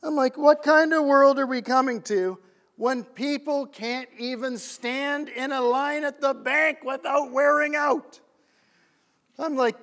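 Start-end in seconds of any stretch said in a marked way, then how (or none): 0.4-8.2 louder, mock-outraged inner rant; acted-out exasperated tirade; worked-up indignation. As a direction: rant in disbelief at the state of the world. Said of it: "getting a little bit worked up".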